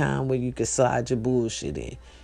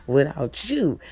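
ordinary talk, nothing marked

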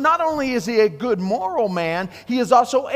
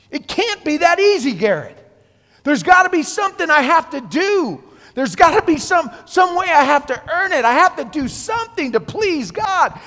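raised, pleading objector voice, building to a shout; doubting listener; 7 semitones higher, 4 dB louder than usual. Acted. To Garrett, a doubter insists he must earn it, pleading loudly. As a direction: protest that grace can't be free.

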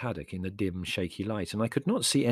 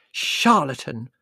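loud, raised accusation; uncharitable; 5 semitones higher, 9 dB louder, slower than usual. A narrator's reading. Mother denouncing Mrs Haddock, uncharitable. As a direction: fling it as an indignant insult. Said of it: "she said uncharitably to Missus Haddock".

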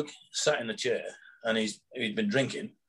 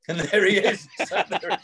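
real laughter through the words, higher and louder; laughing while talking; 8 semitones higher, 3 dB louder than usual. Real, not acted. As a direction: crack up on the punchline. Storyteller cracking up at punchline.

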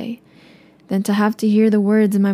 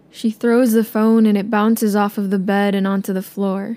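usual speaking voice

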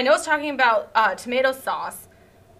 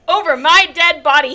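louder mock yell of refusal; yelled defiance; 3 semitones higher, 7 dB louder than usual. Villain refusing to let captive go, hammed-up yell. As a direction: bark it back as a hammed-up no. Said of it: "he yell".

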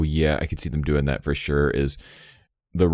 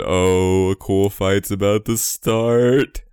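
mock excited; 4 semitones higher, 4 dB louder, much slower than usual. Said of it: "I said".

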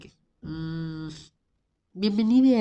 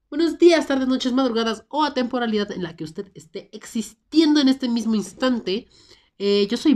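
loud, high, bubbly host greeting; hyped intro; 6 semitones higher, 6 dB louder than usual. Acted. Podcast host greeting listeners, loud and high. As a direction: open the show like a big announcer.

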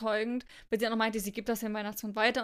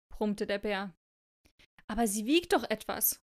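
expressive read-aloud with deliberate phrasing; story narration. A narrator's reading. Reading a snowflake fable to co-host, expressive storytelling.

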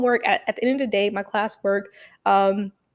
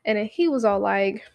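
usual speaking voice

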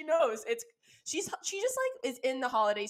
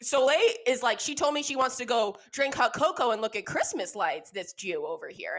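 usual speaking voice

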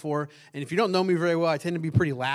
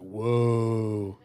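unimpressed whoa; 7 semitones lower than usual. Shown as a demonstration. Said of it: "whoa is just like".

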